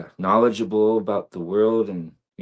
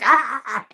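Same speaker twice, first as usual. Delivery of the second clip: relieved sigh; 4 dB louder than usual. Retold or imitated. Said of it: "it's just like".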